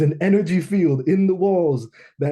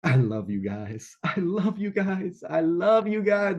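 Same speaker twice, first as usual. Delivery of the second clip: crying; 5 semitones higher, slower than usual. Retold or imitated. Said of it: "I'm just crying my eyes out".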